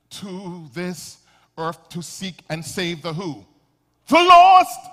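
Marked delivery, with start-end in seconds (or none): 4.1-4.8 booming, high-pitched cry from the pulpit; emphatic shout. Real, not acted. Preacher answering his own question to the congregation, emphatic shout.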